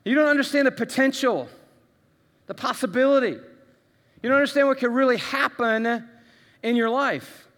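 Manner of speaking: God firmly correcting a proud believer; God's reply. Retold or imitated. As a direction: push back hard on the boast. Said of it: "And he's like".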